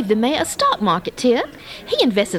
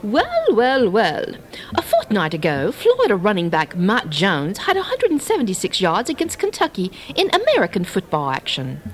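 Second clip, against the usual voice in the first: put-on British accent, pitched lower; 3 semitones lower than usual. [name] previewing LSU and Florida for the coach, put-on British accent. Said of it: "I'll use my British accent".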